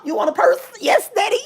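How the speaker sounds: high, giggly retold exchange; hammed-up coy reply; mock sweet girlfriend. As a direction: squeak it out, playfully compliant. Acts out exchange with boyfriend.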